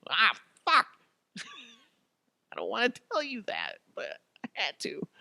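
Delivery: high, embarrassed laugh through the words; laughing confession. Acted. Victim laughs in embarrassment while confessing to Amazon. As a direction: laugh it out, mortified.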